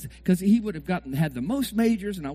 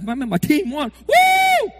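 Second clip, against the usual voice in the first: loud, high whoop of joy; mock victory cheer; 9 semitones higher, 7 dB louder, much slower than usual. Preacher acts out a fan's victory whoop. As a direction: shout it like your team just won. Fan realizing team won.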